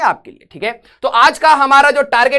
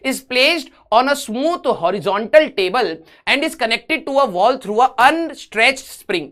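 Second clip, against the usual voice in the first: very slow, word-by-word reading; slow dictation; much slower than usual. Real, not acted. Teacher reading a physics question to students, slow dictation. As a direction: read it out slowly so they can copy it down. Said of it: "reading question aloud slowly".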